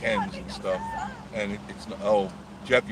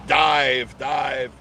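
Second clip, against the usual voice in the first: mock pilot call; 4 semitones higher, 7 dB louder than usual. Pretending to be airplane pilot.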